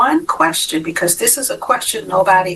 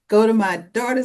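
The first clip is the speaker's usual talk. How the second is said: ordinary talk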